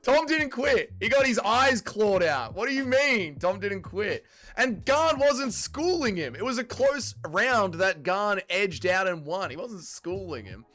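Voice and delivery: loud, high, indignant argument; heated protest. Real, not acted. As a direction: argue it forcefully, drawing out the key words. Heated argument over a fight.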